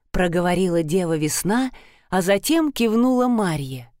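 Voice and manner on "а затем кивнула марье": ordinary delivery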